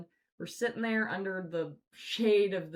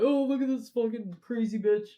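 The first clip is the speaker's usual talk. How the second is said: mock loud sneer; 5 semitones higher, 9 dB louder than usual. Imagined lady mocking them.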